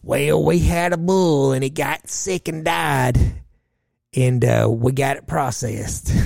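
mother-in-law explaining the dead bull, slow mocking voice; imitating the mom. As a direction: drawl it out like a hillbilly mom. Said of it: "Her mama begins to share".

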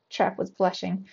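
plain reading voice

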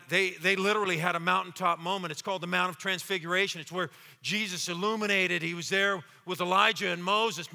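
normal talking voice

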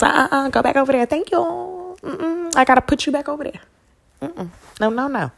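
high, loud, fast sassy dismissal; Serena swat-back. Pretend. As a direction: bat it away with attitude. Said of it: "hitting that Serena Williams".

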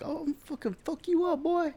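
very high squeaky tough-guy threat; high cartoonish fake threat; mock Dodger fan threat. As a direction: parody a hothead fan's threat. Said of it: "that's how it would sound".

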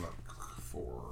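unvoiced quick whisper; whispered aside. Real, not acted. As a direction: whisper it under breath.

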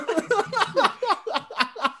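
high, breathy burst of laughter; high-pitched laughing fit. Real, not acted. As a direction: crack up high. Laughing at the joke.

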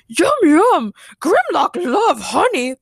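loud, high, greedy robot dinosaur; booming simple-minded monster glee; robotic T-Rex shout. Acted. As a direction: bellow with childlike delight as a robot dinosaur. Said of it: "the robotic T-Rex shouted".

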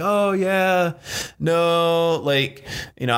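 mock client caught out and stalling; fumbling excuse. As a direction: client dodging the coach's question, lightly voiced.

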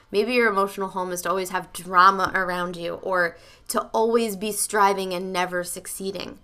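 normal talking voice

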